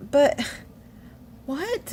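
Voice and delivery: high, disbelieving "but what?"; baffled. Retold or imitated. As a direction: pitch jumps up in confusion. Baffled reply to doctor.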